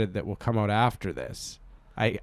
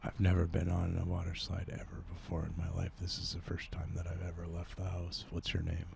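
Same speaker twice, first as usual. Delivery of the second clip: low, soft, subdued character voice; odd sheltered loner; 3 semitones lower, 10 dB quieter than usual. Acted. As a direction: play a quiet oddball stranger, kept low. Improv character on water slide.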